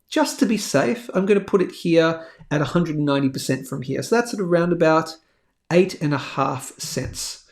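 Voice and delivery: ordinary talk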